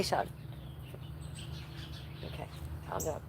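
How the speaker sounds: ordinary delivery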